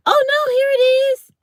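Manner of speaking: very high, loud delighted squeal; excited shriek. Real, not acted. Delighted squeal on finding the weekend picture. Finds missed text, shrieks.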